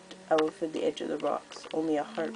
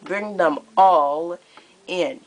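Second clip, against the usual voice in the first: high, louder, theatrically sweet menace; gleeful cruel queen; 4 semitones higher, 7 dB louder than usual. Acted. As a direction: purr out the threat like a delighted tyrant. Scarlet announces her plan.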